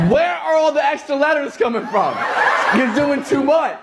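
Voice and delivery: high-pitched comic exasperation; exasperated. Acted. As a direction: complain in incredulous, high-pitched protest. Exasperated rant at the British word.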